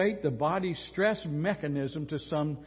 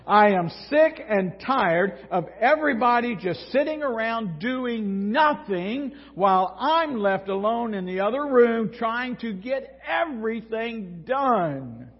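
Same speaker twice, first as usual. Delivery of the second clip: loud, higher, slowed angry rant; fed-up rant; 6 semitones higher, 5 dB louder than usual. Acted. Martha ranting at everyone for sitting around, angry. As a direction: spell out every grievance, hammering it home. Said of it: "reaches the boiling point".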